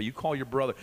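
ordinary delivery

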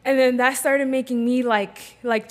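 usual speaking voice

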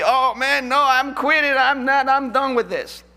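high, loud, hammed-up giving up; mock discouraged quitter. Preacher imitates a new soul winner quitting, high and loud. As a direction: throw up your hands, high and loud. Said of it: "First time might be".

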